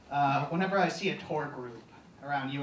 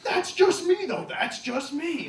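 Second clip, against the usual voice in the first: a hint of a smug anchor's mock modesty, much higher; mock newscaster; 13 semitones higher, 6 dB louder than usual. Anchor backing away from his own opinion, mock humble. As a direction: tack on a prim little disclaimer. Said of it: "the newscaster just gets really indignant".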